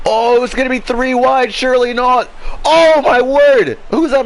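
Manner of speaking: high, loud, thrilled call; excited shout. Real, not acted. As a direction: erupt as the cars go three wide. Three-wide battle, commentator erupts.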